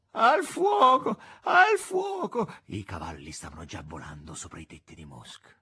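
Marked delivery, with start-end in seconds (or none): 0.1-2.5 high, loud, long-drawn wail for help; piteous cry. A narrator's reading. Cook crying from below, piteous high wail. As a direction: wail the alarm pitifully, very high. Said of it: "pietoso grido della cuoca".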